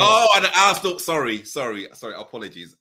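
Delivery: sudden high flustered apology; sorry, sorry, caught out; apologizing too much. Real, not acted. Host forgot guest's Arsenal fandom.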